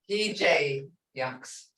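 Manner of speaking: He J yunks slowly, J with a long A like hey. Shown as a demonstration. Teacher correcting a student's vowel, slow careful pronunciation. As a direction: draw out He J yunks, hey-sound on J. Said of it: "It's gotta be hey, A".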